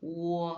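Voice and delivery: "ou" round and long. Shown as a demonstration. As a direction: hold a rounded "ou" out. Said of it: "c'est un son rond et long".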